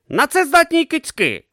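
high, excited inventor voice; eager young inventor. A narrator's reading. Urn dreaming of taming lightning, high and eager. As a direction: dream out loud, high and bright. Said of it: "зауважив Урн".

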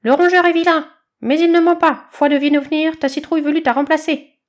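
high, loud insisting squawk; insistent bird chick. Acted. Chick insisting to badger, high and loud. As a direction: press the point in a rattly chick voice.

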